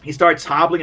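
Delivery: ordinary talk, nothing marked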